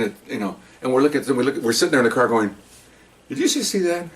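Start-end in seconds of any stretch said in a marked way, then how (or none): 3.3-4.1 surprised. Retold or imitated. Cops spotting lost tourists.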